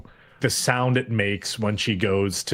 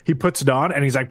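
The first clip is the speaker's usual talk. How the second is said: usual speaking voice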